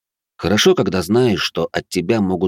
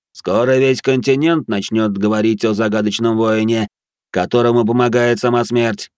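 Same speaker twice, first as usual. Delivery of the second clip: higher, louder solemn guardian; second guard's voice; 4 semitones higher, 3 dB louder than usual. Acted. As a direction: announce the hero's fame grandly. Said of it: "который был чуть выше, но и говорил он чуть быстрее". (голос второго стража, выше и быстрее)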